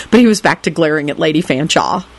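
ordinary delivery, nothing marked

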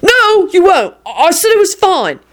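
high, agitated protest; defensive refusal. A narrator's reading. William stopping the doctor examining him, agitated refusal.

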